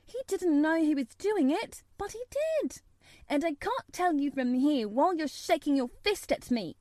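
put-on high, insistent girl's voice; obstinate protest. A narrator's reading. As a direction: argue back stubbornly, pitched high. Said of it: "she protested obstinately".

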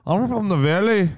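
put-on high, slow, singsong Welsh Valleys accent; mock Welsh accent for the Dolittle clip. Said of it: "what's the Welsh? The Welsh Cardiff Valley".

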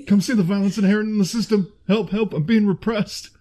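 mock protest, slowed at the end; a hint of an indignant movie peasant; Monty Python peasant. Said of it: "I love that movie".

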